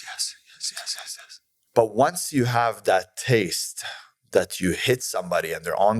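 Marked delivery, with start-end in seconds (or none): 0.0-1.4 breathless whispered yeses; terrified whisper. Retold or imitated. Beaten man whispering yes to the gangster above him, terrified. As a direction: whisper it like you're scared to death. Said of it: "He goes".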